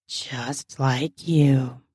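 very slow, low husband's voice; complimenting husband. A narrator's reading. Husband compliments his wife, low and slow. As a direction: draw it out low, for her alone.

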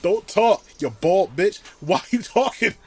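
loud, high-pitched comic outrage; mock-furious, punchy rant; exasperated telling-off. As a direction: scold an absent celebrity, half laughing. Exasperated rant at celebrity's remark.

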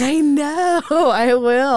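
loud, high Southern twang; Southern accent. Pretend. Teased friend admitting to her accent, in high Southern twang. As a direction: burst out in a playful Southern twang. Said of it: "you'll pull some fucking Southern shit out".

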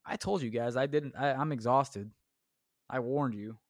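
ordinary talk, nothing marked

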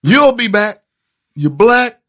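movie curse quote. Retold or imitated. Quoting Color Purple curse lines.

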